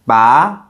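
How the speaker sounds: "Ba" rising like a question; questioning, climbing "Ba"; second tone. Shown as a demonstration. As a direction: slide "Ba" upward in pitch. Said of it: "The second tone rises".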